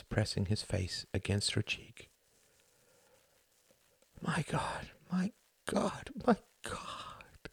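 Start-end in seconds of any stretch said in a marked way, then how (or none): 4.2-7.5 voiceless, rising hushed repetition; murmured whisper. Acted. Son whispering against his mother's cheek. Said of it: "he murmured".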